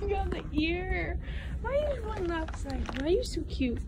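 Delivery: high cooing puppy talk. Real, not acted. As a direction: coo at the puppy. Talking to puppy.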